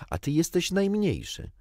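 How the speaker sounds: high-pitched, sharp insistence. A narrator's reading. Wendy telling Michael he is the smallest, sharp. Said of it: "powiedziała ostro Wendy".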